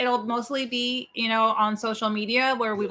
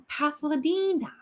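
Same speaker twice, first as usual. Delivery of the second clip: higher-pitched put-on vampire voice; Naja impersonation; 4 semitones higher than usual. Shown as a demonstration. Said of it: "Naja impersonation".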